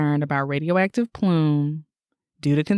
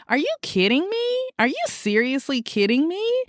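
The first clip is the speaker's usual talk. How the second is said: high, incredulous outcry; outraged disbelief; 10 semitones higher than usual. Real, not acted. Ranting to cohost about the plume, disbelieving high outcry. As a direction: voice climbing in disbelief.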